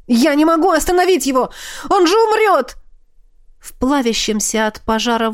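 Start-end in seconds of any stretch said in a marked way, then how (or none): 0.1-2.8 desperate cry. A narrator's reading. Desperate cry, dragon dying.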